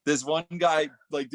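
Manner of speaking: ordinary talk, nothing marked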